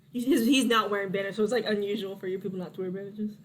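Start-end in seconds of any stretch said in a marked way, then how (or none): none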